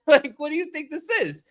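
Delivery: high-pitched mock outrage; incredulous comeback. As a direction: protest in comic disbelief. Acts out indignant comeback.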